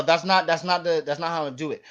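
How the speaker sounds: ordinary talk, nothing marked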